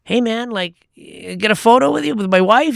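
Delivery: high, hopeful ask for a photo; eager request. Retold or imitated. Asking wrestler for photo with wife, eager. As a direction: ask sweetly like a nervous fan. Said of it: "I'm like".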